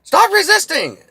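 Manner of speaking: very high, loud screamed command; mock cop yell. Cop screaming the code at a suspect, high loud yell. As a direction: scream it like an officer mid-arrest. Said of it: "That's code".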